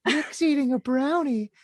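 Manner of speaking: high, drawn-out mocking imitation; exaggerated high-pitched impersonation. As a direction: mimic her in a high voice, stretching out the last words. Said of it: "She sounds like that".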